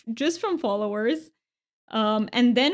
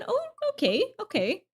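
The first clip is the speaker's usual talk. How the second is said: a much higher startled oh; surprised; 10 semitones higher, 3 dB quieter than usual. Real, not acted. Streamer reacts to a viewer's odd accent request, surprised. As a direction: caught off guard, then accepting.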